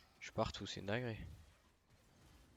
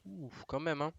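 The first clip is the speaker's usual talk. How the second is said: relieved; 8 semitones higher, 6 dB louder, slower than usual. Real, not acted. Relief exclamation.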